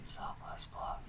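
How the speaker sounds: stage whisper; playful whispered aside. Acted. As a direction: slip the joke in under your breath. Joking aside during a plug.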